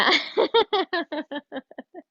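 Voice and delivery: breathy giggle. Real, not acted. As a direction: giggle to yourself. Laughs at own instructions.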